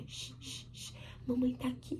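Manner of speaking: soft shushing, then hushed words; soothing a newborn. Retold or imitated. Mother calming her newborn Liz at the hospital, soft and soothing. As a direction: hush the baby very gently. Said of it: "Eu fiz assim pra Liz".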